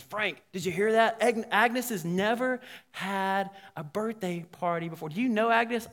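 mildly urgent, acted-out appeal; eager retold question. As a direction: press the question with lifted pitch. Urgent question after overhearing Agnes.

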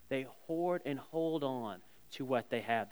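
"hoard" said low and quiet the second time; soft repeat. Real, not acted. Pastor to congregation, quiet repeated emphasis. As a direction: drop to a hushed echo on "hoard".

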